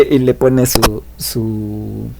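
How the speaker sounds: normal talking voice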